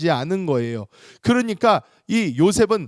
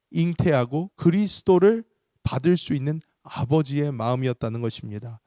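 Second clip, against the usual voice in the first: usual speaking voice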